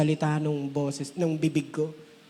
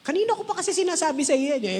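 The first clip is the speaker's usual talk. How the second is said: much higher, fast nagging; nagging spouse; 11 semitones higher, faster than usual. Retold or imitated. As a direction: nag like an impatient partner. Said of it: "Ganun pa, di ba?". (mataas at mabilis na pagmamaktol)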